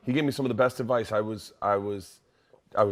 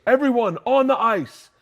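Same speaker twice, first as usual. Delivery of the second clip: coach barking orders; 12 semitones higher, 8 dB louder than usual. Retold or imitated. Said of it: "he goes".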